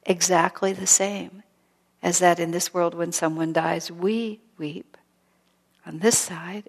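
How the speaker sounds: usual speaking voice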